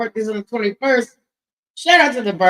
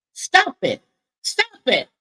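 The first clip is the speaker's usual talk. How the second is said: mock scold; 11 semitones higher, slower than usual. Real, not acted. Annoyed at echo.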